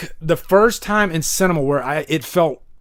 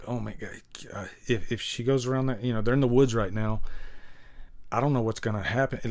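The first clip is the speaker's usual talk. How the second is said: hushed and nervous, a bit fast, much softer; scared viewer hush; 6 semitones lower, 11 dB quieter, faster than usual. Retold or imitated. A viewer frightened by The Witch, hushed and nervous. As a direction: murmur it like you're frightened in a dark cinema. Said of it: "You're like".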